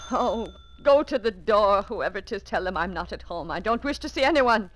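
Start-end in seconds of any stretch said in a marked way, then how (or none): none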